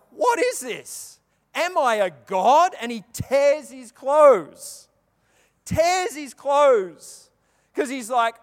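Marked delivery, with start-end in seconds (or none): 0.1-2.7 alarmed king. Retold or imitated. King of Israel panics, voiced. 2.8-7.3 drawn-out emphatic telling, louder; slow dramatic emphasis. Real, not acted. Preacher stressing the king tearing his clothes, slow and loud.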